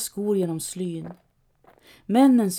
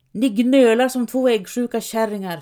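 louder scornful snap; hissed scolding; 3 semitones higher, 6 dB louder than usual. A narrator's reading. Knote scolding the complaining robbers, scornful. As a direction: snap it at the whiners. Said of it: "fräser Knote".

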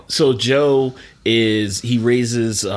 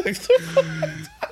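high laugh. Real, not acted. Big laugh.

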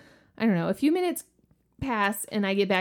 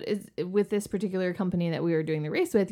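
ordinary talk, nothing marked

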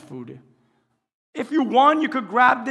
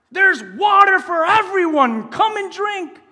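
calling out; 8 semitones higher, 4 dB louder, slower than usual. Acted. Preacher voicing God's invitation.

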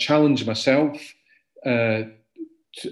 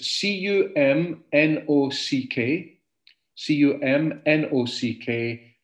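slow, letter-by-letter spelling; 3 semitones higher, slower than usual. Shown as a demonstration. Composer spelling his hometown for listeners, slowly, letter by letter. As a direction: spell it slowly for someone writing it down.

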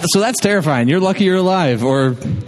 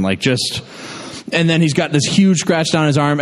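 usual speaking voice

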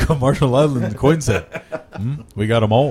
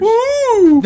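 mock cheer; 5 dB louder than usual.

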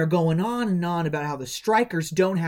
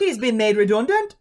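higher put-on British film accent; imitated film accent; 4 semitones higher, 4 dB louder than usual. Host echoing the film's redundancy line, imitated accent.

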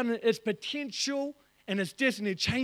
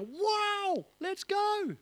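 much higher, eager "Wow, let's go"; mock excitement; 7 semitones higher, 3 dB quieter, much slower than usual. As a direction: play it like someone fired up. Imagined eager response.

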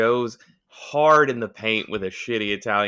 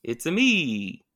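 Mario voice; 6 semitones higher, slower than usual. Acted. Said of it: "Italian-American Chris Pratt".

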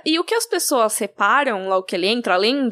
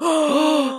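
big theatrical gasp; mock scandalised intake of breath; shocked gasp; 4 semitones higher than usual. Imagining everyone in the awkward room, exaggerated gasp.

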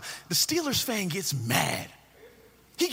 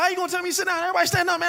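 indignant fan; 10 semitones higher, 3 dB louder, much faster than usual. Retold or imitated. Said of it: "He's like".

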